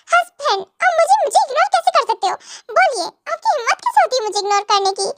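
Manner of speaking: wounded, high-pitched telling-off; indignant scolding. Acted. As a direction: demand answers from a husband who ignored you. Wife scolds husband for ignoring her.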